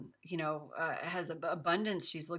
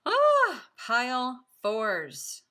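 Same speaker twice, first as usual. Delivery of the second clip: much higher, louder cry, then slow pile fours; excited cry; 6 semitones higher, 10 dB louder, much slower than usual. Real, not acted. Tarot reader thrilled by the pile four spread, excited cry. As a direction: burst out in delight, then draw out the words.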